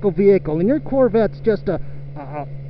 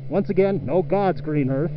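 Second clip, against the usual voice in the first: ordinary talk, nothing marked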